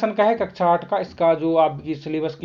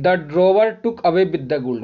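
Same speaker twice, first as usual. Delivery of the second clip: slow dictation; 5 dB louder, much slower than usual. Shown as a demonstration. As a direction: answer said slowly and clearly. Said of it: "इसका सही answer हो जाएगा". (धीमे बोला गया उत्तर)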